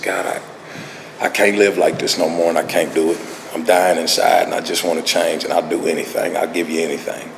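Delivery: low, soft, mildly defeated appeal; a quiet retold plea, worn down; mildly desperate plea. Alcoholic begging God for change, mildly desperate.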